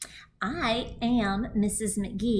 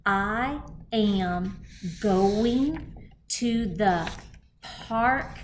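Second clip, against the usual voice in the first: slow read-aloud; 3 dB louder, much slower than usual. Shown as a demonstration. Teacher reading the sentence aloud with kindergartners. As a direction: read "I am going to the park today" very slowly, word by word. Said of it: "let's read again".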